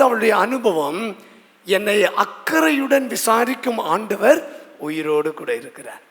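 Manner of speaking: usual speaking voice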